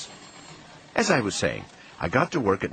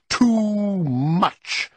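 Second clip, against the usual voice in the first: very high, long-drawn yell; climactic yell; 12 semitones higher, 4 dB louder than usual. A narrator's reading. Grandfather at his wit's end over spending, yelling. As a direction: stretch it out at the top of the voice.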